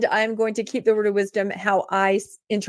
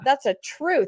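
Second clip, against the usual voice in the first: ordinary talk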